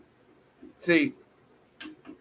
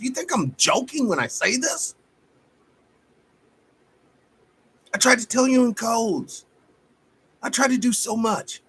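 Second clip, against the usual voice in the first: real fired-up outburst; 11 semitones higher than usual. Real, not acted. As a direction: pitch shoots much higher in heated frustration. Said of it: "I'm fired up this morning".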